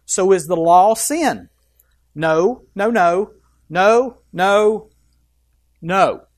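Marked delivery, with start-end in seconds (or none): 2.1-6.3 louder, insistent row of no's; repeated loud no. Acted. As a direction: pound each no like a fist on the pulpit. Preacher hammering Paul's answer.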